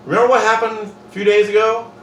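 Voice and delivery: a hint of a pointed reminder; reproachful Jesus. Jesus pretending to scold the disciples who ran away, mock reproach.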